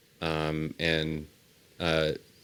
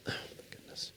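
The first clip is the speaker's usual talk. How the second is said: whisper; 16 dB quieter than usual. Real, not acted.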